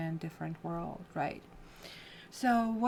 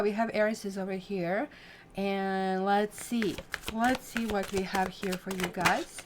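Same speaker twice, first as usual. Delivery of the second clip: normal talking voice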